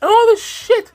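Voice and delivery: high-pitched, incredulous exasperation; exasperated. Real, not acted. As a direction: plead in disbelief, voice climbing high. Exasperated rant at the streamer.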